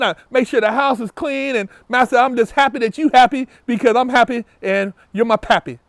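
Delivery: mockingly grovel to a master, pitch rising into eager praise; hammed-up subservient gushing; mock servile worshipper. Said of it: "that's the mentality of some of you guys".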